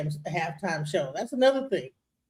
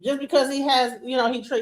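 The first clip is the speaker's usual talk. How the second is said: normal talking voice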